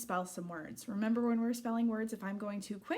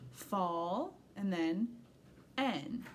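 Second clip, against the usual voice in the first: slow sounding out; much slower than usual. Shown as a demonstration. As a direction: fall stretched out slowly. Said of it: "write the first syllable".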